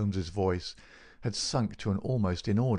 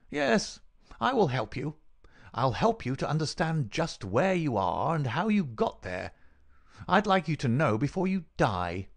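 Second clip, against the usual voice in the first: higher-pitched, gloating villain; taunting villain; 6 semitones higher than usual. Acted.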